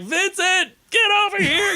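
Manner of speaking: put-on very high, loud child's scold; high piping command; little brother scolding. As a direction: call like a small boy bossing his brother. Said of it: "even Victor's like".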